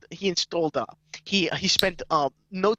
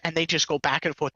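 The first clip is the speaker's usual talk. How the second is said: normal talking voice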